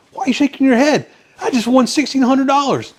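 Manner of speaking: high, loud, excited outburst. Acted. Banker to the lawyer after winning his bet, overexcited. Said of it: "he's just so excited".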